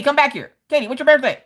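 ordinary delivery

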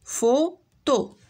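فوتو said slowly as fo-to, two separate beats; syllables clapped out. Shown as a demonstration. Teacher to pupils, counting syllables: فوتو split slowly into fo-to. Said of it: "غير زايدين".

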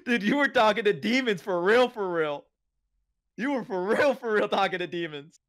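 high, loud, laughing insistence; giddy amazed friend; excited laughing disbelief. Real, not acted. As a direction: crack up while insisting it was real. Laughing at the ghost story.

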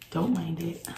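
normal talking voice

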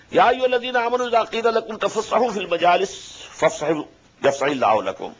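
measured chanted Arabic recitation; Quran recitation. Acted. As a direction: intone the verse as in tilawa.

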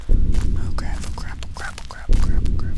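whispered chant of dread; frightened, voiceless repetition; panicked whisper. Real, not acted. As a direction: whisper it over and over in alarm. Scared muttering while playing.

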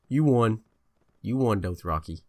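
loud, bitter mock congratulations; sarcastic loud taunt. Real, not acted. Player yelling sarcastically at the victorious Dothraki, loud bitter taunt.

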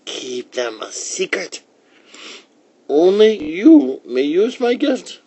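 put-on high old lady voice, rising to loud; from hushed secret to high, loud warning; old woman warning. A narrator's reading. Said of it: "warned the old woman".

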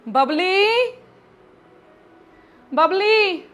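calling her daughter across the house. Acted. ਮਾਰਦੀ ਆ ਬਬਲੀ ਨੂੰ ਆਵਾਜ਼.

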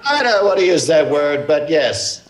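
Trump impression.

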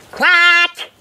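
high, bright-vowelled working quack; one long, high triumphant quack; excited duck quack. A narrator's reading. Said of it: "excitedly tried it out".

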